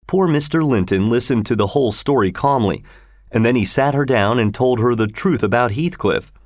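ordinary delivery

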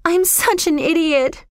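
put-on high-pitched, self-reproachful girl's voice; surprised. A narrator's reading. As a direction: exclaim in high, acted dismay. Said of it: "looking surprised".

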